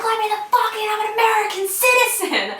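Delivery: high, loud re-enacted scream. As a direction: re-enact screaming to be let inside. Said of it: "and I'm screaming".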